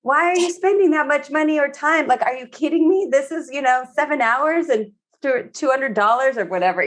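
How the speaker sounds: high-pitched mock disbelief at a partner; exasperated complaint. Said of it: "then later we're like".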